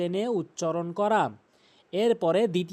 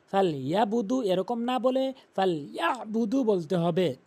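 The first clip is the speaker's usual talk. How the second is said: ordinary talk, nothing marked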